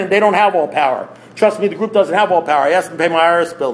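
usual speaking voice

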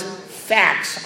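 Facts said hushed and breathy, held for effect; a stage-whispered Facts, like a big reveal; dramatic reveal. Retold or imitated. Stage-whispering Facts to the audience as a punchline. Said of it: "you ready for this?".

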